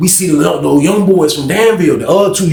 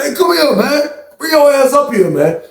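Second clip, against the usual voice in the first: high-pitched, impatient summons; retold holler up the steps; insistent calling; 11 semitones higher than usual. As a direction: imitate badgering a buddy to come up. Insistent call up the steps, acted.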